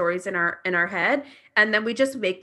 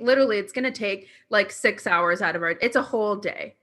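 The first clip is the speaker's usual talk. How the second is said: normal talking voice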